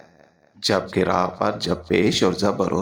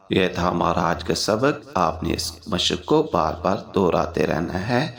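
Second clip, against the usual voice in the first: usual speaking voice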